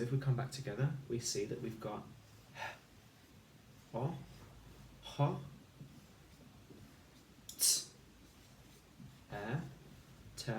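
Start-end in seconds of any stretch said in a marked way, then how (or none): 2.5-10.5 h, o, ho, t, e, te said as separate sounds with pauses; segmented phonics sounds; sounding out phonics. Shown as a demonstration. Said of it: "say the sounds as you write them".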